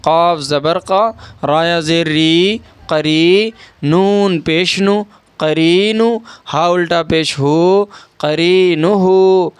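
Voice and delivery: slow letter-by-letter recitation, long "ری" and "ہو"; drawn-out spelling. Shown as a demonstration. Teacher building the word piece by piece, slowly stretching "ری" and "ہو". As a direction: stretch "ری" and "ہو", keep "نو" short. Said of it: "ایک الف کے برابر کھینچ کر پڑھیں".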